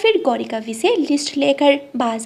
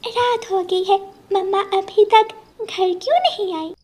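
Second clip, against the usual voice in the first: put-on higher, anxious child's voice; worried little girl; 8 semitones higher than usual. Daughter waiting at the window for mother, higher worried voice. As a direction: wonder where mama is, childlike.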